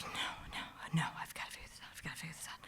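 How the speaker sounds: soft unvoiced whisper, fading away; panicked whisper. On the floor, whispering herself into action. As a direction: whisper it fast to steady yourself. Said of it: "I was like".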